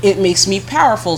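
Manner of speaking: ordinary delivery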